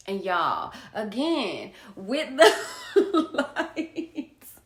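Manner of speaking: laughing at her own slip while reading. Real, not acted. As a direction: giggle through the words. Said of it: "hold on".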